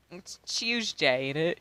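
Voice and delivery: slow mock-British "It's Tuesday, ain't it?". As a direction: drawl it like a Londoner, slow. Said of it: "in my British tone".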